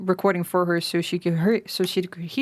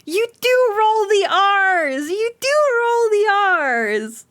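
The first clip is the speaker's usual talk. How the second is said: much higher, louder, slow delighted exclamation; thrilled to be proven right; excited squeal; 10 semitones higher, 5 dB louder, slower than usual. Real, not acted. Streamer hears friend's voice clip, shrieking with delight. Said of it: "that makes me so excited".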